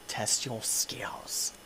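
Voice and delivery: slow breathy whisper; slow eerie whisper. Acted. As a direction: breathe it like a secret taunt. Whispered game taunt.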